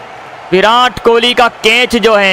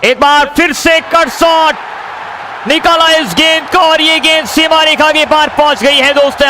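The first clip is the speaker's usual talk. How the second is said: excited cricket commentary; 4 semitones higher than usual. Real, not acted. Boundary call, shouting commentator.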